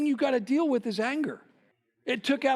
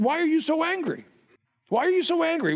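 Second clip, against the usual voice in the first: a stern God, questioning Cain, pitched higher; a touch louder rebuke to Cain; voicing God; 7 semitones higher, 3 dB louder than usual. God confronting Cain in sermon.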